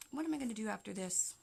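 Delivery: normal talking voice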